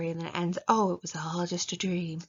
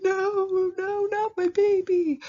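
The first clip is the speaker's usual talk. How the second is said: much higher, slow wailing mock anguish; mock despair; 12 semitones higher, 4 dB louder, much slower than usual. Reader devastated by an emotional ending, hammed-up high wail. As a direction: wail it like a heartbroken parent, hammed-up. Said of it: "the emotion one is the, like, falling".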